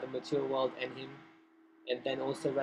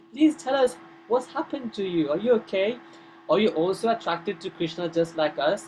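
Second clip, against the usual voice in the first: higher, louder, earnest questions; concerned pleading; 5 semitones higher, 6 dB louder than usual. Retold or imitated. Friends coaxing the sad demigoddess to speak, pleading. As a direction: plead for an answer. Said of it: "You seem a bit dejected".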